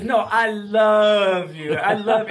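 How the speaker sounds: put-on slow, loud, insistent little-kid voice; insistent toddler. Two-year-old grandson insisting to grandpa, slow and loud. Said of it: "He goes".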